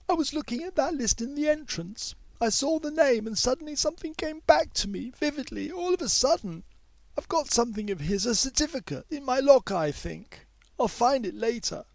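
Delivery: a schoolgirl remembering something in a rush; young girl Mei Yi. A narrator's reading. Mei Yi tells the teacher about a name, eager girl's voice.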